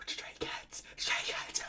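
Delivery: voiceless, hushed fan whisper; awed whisper. Real, not acted. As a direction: whisper it in stunned awe. Awestruck fan reacting to the video.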